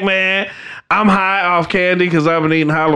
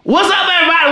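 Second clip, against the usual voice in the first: hype host greeting; 9 semitones higher, much faster than usual. Real, not acted. Host shouting show greeting.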